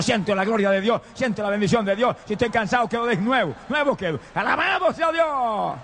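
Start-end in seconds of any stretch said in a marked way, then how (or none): 4.3-5.8 high, long-drawn praise cry; real revival-meeting cry; ecstatic praise. Real, not acted. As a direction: stretch it out as a fervent shout of worship. Preacher cries out praise.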